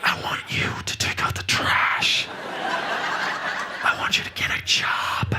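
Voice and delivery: whispered nagging instructions; a mom ordering chores. Retold or imitated.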